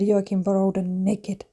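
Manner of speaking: sheepishly drawn-out quote of the outburst; deadpan retelling. Retold or imitated. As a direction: spell out the outburst slowly and quietly. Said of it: "just burst out and said".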